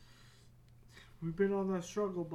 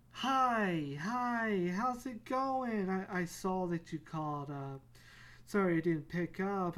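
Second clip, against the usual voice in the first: false confidence; 3 dB louder than usual. Acted. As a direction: put on cheer to cover your nerves. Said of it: "drug yourself with false confidence".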